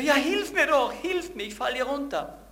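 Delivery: high, loud frantic cry for help; desperate shout. Acted. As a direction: shout up from the cliff edge. Man on cliff yelling.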